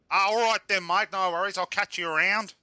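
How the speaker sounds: breezy, high-pitched Aussie sign-off; Australian accent. Acted. Driver saying see you around, high Australian accent. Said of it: "speaking in your accent anymore".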